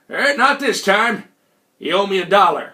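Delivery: put-on Old Man McGillicuddy. Old hustler telling the visitor he lost, drawling character voice. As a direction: play a drawling old card sharp. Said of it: "Mister McGillicuddy".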